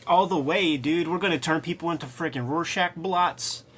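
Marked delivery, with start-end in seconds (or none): none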